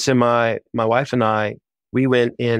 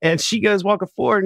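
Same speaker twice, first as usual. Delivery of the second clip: ordinary talk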